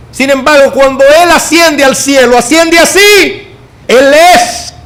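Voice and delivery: high, slow, shouted climax; preaching shout. Acted. Pastor proclaiming the ascension to the church, rising shout. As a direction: lift it up and cry it out slowly.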